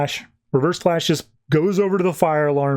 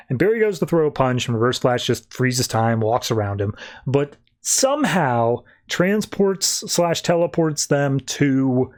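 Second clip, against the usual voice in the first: usual speaking voice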